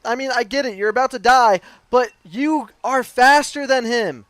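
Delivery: worked-up, high-pitched telling-off; exasperated rant. Real, not acted. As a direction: complain loudly in frustrated disbelief. Rants at the failed substitute.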